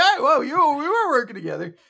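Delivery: high, hurried mock panic; play-acted alarmed objection; flustered protest.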